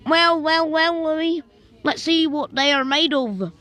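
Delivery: loud, high, sneering drawl; taunting bully. Retold or imitated. Girl taunting Louis, loud and high. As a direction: savour each well like a villain.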